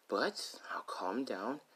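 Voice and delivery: slow, soft whisper to herself; whispered self-calming. Acted. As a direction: talk yourself down in a slow whisper. Rose fuming under her breath.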